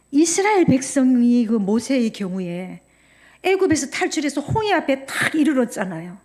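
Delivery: loud, driving sermon delivery; full-force preaching. Acted. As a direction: preach it to the whole congregation.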